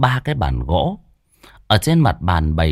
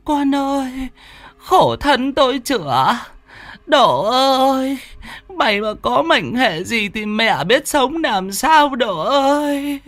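much higher, slow keening lament; wailing mother. Acted. Mother running after her son, keening and wailing. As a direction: wail for your dying son. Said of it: "miệng khóc bổ lô".

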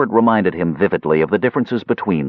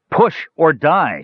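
slow, higher shouted command; shouted order; 7 semitones higher, slower than usual. A narrator's reading. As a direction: bark it out, slow and hard. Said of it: "shouted Tanis".

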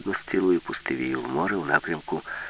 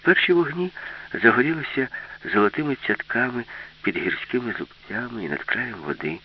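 ordinary delivery, nothing marked

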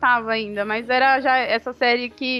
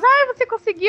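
higher, louder eager question; excited friend; 10 semitones higher, 5 dB louder than usual. Retold or imitated. Girlfriends outside asking if she managed it, excited retold question. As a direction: imitate a friend squealing for news. Colleagues eagerly ask for news.

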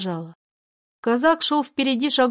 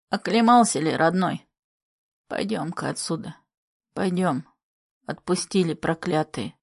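put-on tender old grandfather; 5 semitones lower than usual. Grandfather comforts the boy.

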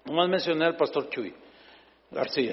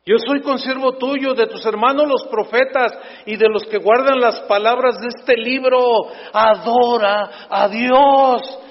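stern angel; 5 semitones higher, 6 dB louder than usual. Acted. Angel's repeated command.

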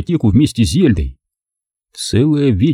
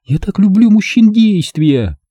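lightly voiced seductive woman, pitched higher; higher woman's voice, lightly played; Zelda's voice; 11 semitones higher, 3 dB louder than usual. Zelda's slow declaration.

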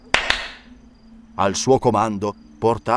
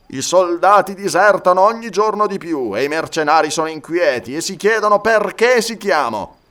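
higher, forceful warning; agitated mercenary captain; 6 semitones higher, 5 dB louder than usual. Acted. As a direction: press the alarm as a restless soldier. Voices agitated mercenary leader. (capitano mercenario agitato, voce più alta)

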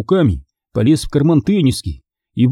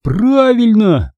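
high, drawn-out exclamation; exclaiming; 9 semitones higher, much slower than usual. A narrator's reading. Boy seizing on his friend's plan, exclaiming. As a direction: exclaim it in keen agreement. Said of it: "воскликнул Конор".

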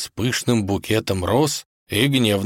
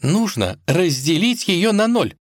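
lightly voiced higher princess; a hint of an eager princess; determined princess; 10 semitones higher than usual. A narrator's reading. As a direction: pitch it up, sound resolute. Princess announces her bold decision.

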